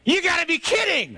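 high, comic yell of disbelief; incredulous shout. Acted. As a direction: holler it back in disbelief over the storm. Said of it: "he looks at me and he goes".

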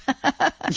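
short, high burst of laughter; amused laugh. Real, not acted. Host amused while reassuring the caller.

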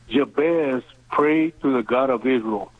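ordinary talk

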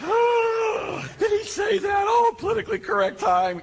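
very high, squealed fake horror; hammed-up pearl-clutching shriek; mock shocked gasp. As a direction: imitate scandalised onlookers in falsetto. Acts out scandalised listeners.

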